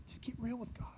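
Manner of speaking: whispered aside to the congregation; very soft, unvoiced counsel; hushed urging. Real, not acted. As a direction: drop to a whisper and urge them gently.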